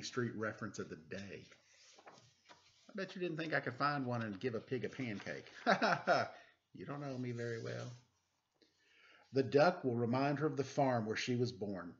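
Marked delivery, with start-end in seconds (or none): 5.5-6.4 short laugh. Real, not acted. Laughs at his own joke.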